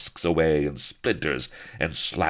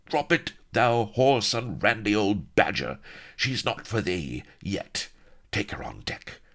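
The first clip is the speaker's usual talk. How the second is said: raised, forceful scolding order; commanding pirate woman; 6 semitones higher than usual. A narrator's reading. Sheba to Firebeard after kicking him, commanding.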